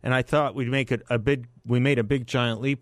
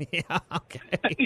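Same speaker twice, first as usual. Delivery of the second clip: laughing; 6 semitones higher, 3 dB quieter, slower than usual. Real, not acted. Laughs at caller's joke.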